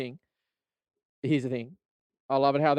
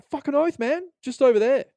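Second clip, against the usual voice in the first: very high, louder chirpy reply; eager staffer; 12 semitones higher, 6 dB louder than usual. Retold or imitated. Staffer pointing a customer to laptops, mock eager. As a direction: beam at the customer. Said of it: "they'll be like".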